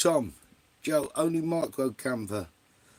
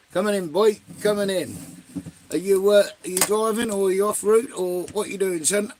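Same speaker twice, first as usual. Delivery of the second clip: loud call-out; 5 semitones higher, 6 dB louder than usual. Real, not acted. Calling out to Reg.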